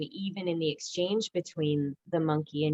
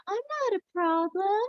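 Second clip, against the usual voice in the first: squeaky high innocent little voice; innocent monkey. Acted. Teacher voicing her innocent drawn monkey, high and sweet.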